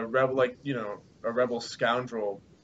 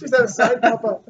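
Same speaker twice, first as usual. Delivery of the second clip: put-on high Scottish-accented child voice; 9 semitones higher, 7 dB louder than usual. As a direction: chirp it like a kid with a Scottish accent. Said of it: "it was like a Scottish accent".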